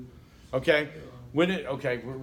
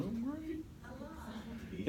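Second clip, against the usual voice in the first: higher, much softer sarcastic sigh; dry mock delight; sarcastic oh great; 11 semitones higher, 14 dB quieter than usual. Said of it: "And I'm like going".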